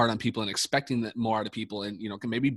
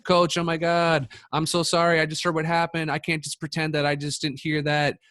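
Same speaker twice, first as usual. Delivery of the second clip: higher-pitched mock-distressed student voice; imitating shocked sympathy; 6 semitones higher, 3 dB louder than usual. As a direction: act out a dismayed athlete consoling the coach, pitch raised. Voices athletes' shocked sympathy texts.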